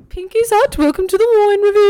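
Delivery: high, posh mock host voice; mocking the friend's fancy intro; fancy pinkies out. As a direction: impersonate a snobby wine host, pinkies out. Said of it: "You're being so, like".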